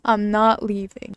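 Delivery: usual speaking voice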